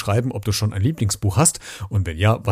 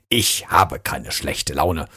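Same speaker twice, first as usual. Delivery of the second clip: a sulky little Jim digging in his heels; mildly grumbling denial, lower and slow; grumpy insistence; 7 semitones lower, slower than usual. Acted. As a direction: lower and slow, stubbornly denying it. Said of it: "behauptete Jim".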